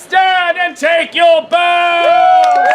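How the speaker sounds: slow, drawn-out finale shout; calling out. Acted. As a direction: proclaim the curtain call grandly. Performer closes the show.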